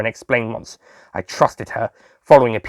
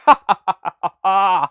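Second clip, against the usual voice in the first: amused laugh; 5 semitones higher than usual. Acted. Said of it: "I laughed".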